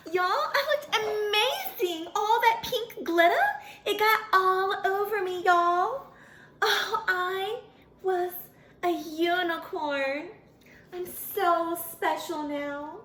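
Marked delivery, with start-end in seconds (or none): none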